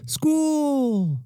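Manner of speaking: put-on high, delighted little-girl cheer; excited cheer. Girl cheering on her way to school, high and delighted. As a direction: squeal it with joy like a kid racing to school.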